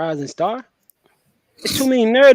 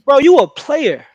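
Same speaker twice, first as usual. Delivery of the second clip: higher, louder, slow put-on voice praising a player; mock owner flattery; 9 semitones higher, 7 dB louder, slower than usual. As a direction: sweet-talk him like a team owner.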